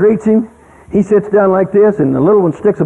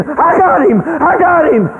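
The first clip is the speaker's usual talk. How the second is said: put-on child screaming; 9 semitones higher, slower than usual. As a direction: shout it like a thrilled four-year-old. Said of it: "Gabriel's screaming".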